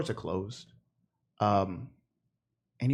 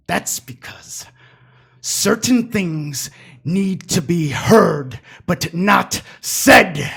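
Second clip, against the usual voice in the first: slow and deliberate; 5 semitones higher, 10 dB louder, much slower than usual. Acted. NPC slow menacing point.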